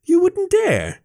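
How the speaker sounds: very high-pitched scandalised gasp; gasped shock. A narrator's reading. Fox hears Jason's threat of walking home, shocked gasp. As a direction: squeal it in mock disbelief. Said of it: "I gasped".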